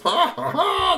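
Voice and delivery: shrill loud jeering cackle; mocking high laugh. Bullies laughing at Tony, cackling. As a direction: cackle at him.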